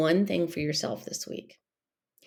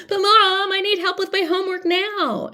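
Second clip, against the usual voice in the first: put-on high, pleading kid voice, pitched way up and loud; whiny kid voice; 12 semitones higher, 5 dB louder than usual. Kid interrupting mom to demand homework help, high and whiny. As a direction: beg like a kid who needs homework help now. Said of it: "The kids may, you know, be like".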